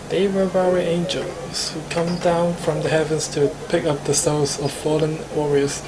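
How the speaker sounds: ordinary talk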